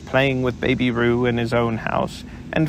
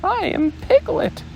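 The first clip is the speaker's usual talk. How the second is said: much higher, emphatic squeak; insistent Piglet. Acted.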